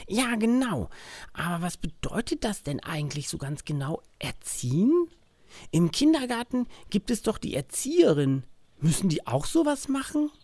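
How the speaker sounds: put-on high, eager little boy; child voice. Max asks curious questions. (neugierige Kinderstimme)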